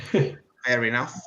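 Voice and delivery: usual speaking voice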